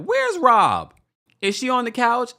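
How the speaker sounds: high, loud mock calling-out; imitating a castmate's jab. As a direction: loudly mimic someone asking after a missing friend. Mimics Wendy's confessional jab.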